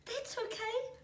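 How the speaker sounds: high, shaky-sounding small voice; stammering little kiwi. A narrator's reading. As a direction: stammer it out, still shaken. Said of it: "stammered the little Kiwi".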